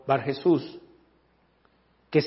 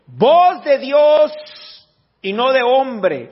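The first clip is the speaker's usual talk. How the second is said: loud, high, drawn-out acclamation; crowd acclaiming; 9 semitones higher, 8 dB louder, much slower than usual. Acted. People acclaiming Herod as a god, shouting. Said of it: "el pueblo aclamaba gritando".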